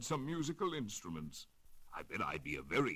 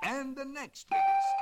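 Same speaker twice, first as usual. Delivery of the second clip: much higher and louder held note; top note; 8 dB louder than usual. Acted. Octopus reaches the top shell, high loud held note. Tapping turtle shells as notes.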